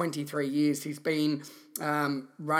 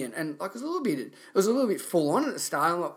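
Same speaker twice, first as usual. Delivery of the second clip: ordinary talk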